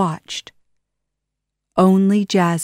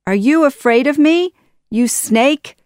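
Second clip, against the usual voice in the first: fast, higher, louder taunt; taunting cry; 8 semitones higher, 6 dB louder than usual. Acted. Aladdin taunting Jafar, loud fast cry. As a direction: goad the villain. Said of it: "cried Aladdin".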